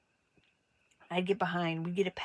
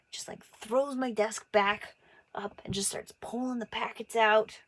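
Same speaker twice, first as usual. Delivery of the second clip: usual speaking voice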